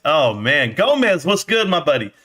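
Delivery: ordinary delivery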